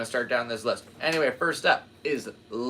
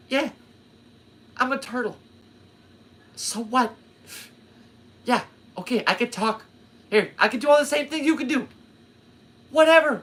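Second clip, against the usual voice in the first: much higher, loud put-on Bill Burr, fast and dismissive; Bill Burr impression; 14 semitones higher, 6 dB louder than usual. Vlogger mocking Bill Burr's turtle, high and dismissive. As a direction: toss it off like a cocky, shrugging turtle. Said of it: "classic Bill Burr".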